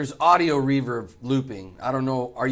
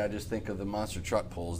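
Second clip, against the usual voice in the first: ordinary delivery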